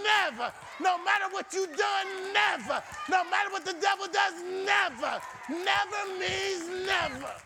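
high, emphatic sermon delivery; rousing preacher in full cry; full-force preaching. Real, not acted. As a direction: preach it hard with ringing repeats.

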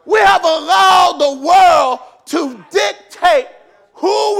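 loud, fervent pulpit preaching; preaching at full force. Acted. Pastor rousing the church about authority, full-force preaching. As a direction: drive it home to the congregation.

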